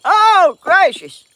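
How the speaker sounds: high, loud, slow "oh" of disbelief; drawn-out exclamation, flattered; surprised exclamation. Real, not acted. Reacting to being called beautiful.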